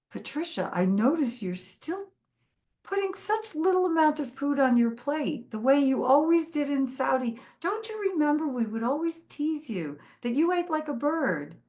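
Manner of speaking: a mild imitation of a doting father-in-law; voicing father-in-law. Father-in-law quoted at family lunch.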